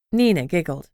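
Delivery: ordinary delivery, nothing marked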